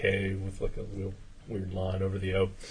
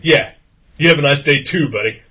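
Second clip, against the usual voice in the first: loud, higher parting shot; imitated sarcastic call; 5 semitones higher, 14 dB louder than usual. Heckler to the customer walking out, loud sarcastic send-off. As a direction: call it after him as the mock-friendly heckler.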